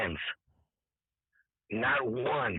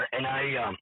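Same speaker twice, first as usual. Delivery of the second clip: usual speaking voice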